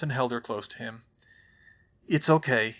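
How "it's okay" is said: usual speaking voice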